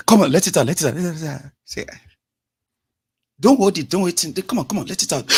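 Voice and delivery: egging someone on to cry it out, loudly; mock pep-talk shout; loud coaxing.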